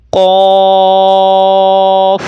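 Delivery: drawn-out full-mouthed قوف; thick letter. Shown as a demonstration. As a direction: recite قوف heavy and rounded on a steady note. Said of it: "موٹی آواز سے پڑھنا ہے".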